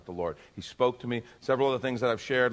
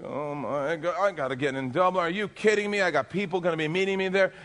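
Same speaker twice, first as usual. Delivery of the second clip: frantic pleading; 7 semitones higher, 3 dB louder than usual. Retold or imitated. Frantic at the ticket counter.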